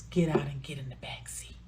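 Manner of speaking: low whispered order; whispered command. Retold or imitated. John whispering to Lisa to get in the back. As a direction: hiss the order quietly, no room to argue.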